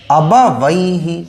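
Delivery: अबा वई ही read very slowly, syllable by syllable; slow spelled-out reading. Shown as a demonstration. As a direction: draw out अबा वई ही so each sound is clear. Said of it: "बा है और वा है". (अबा वई ही को बहुत धीरे, एक-एक अक्षर अलग करके पढ़ना)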